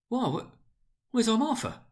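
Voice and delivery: put-on a father looking round for his girl; father calling. A narrator's reading. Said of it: "cried Bob Cratchit".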